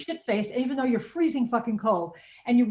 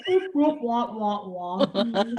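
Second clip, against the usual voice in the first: mock siren noises; much slower than usual. Shown as a demonstration. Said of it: "it sounds like Krankenhaus".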